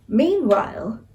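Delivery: plain reading voice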